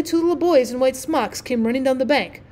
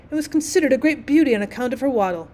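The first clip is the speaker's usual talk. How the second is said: ordinary delivery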